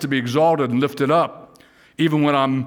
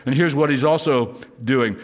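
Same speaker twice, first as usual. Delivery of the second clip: ordinary delivery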